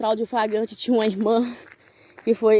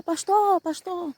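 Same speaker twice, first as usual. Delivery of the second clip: retold calling out; 8 semitones higher, much slower than usual. New arrival at the pastor's house, half-voiced high call. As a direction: call out high, at a held-back volume. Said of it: "eu comecei a gritar".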